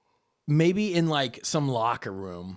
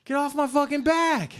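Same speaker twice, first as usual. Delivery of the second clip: much higher, sharper irritated snap; annoyed brush-off; 11 semitones higher than usual. Retold or imitated. Brother snapping at his sister, stronger irritation. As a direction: bite it off harder.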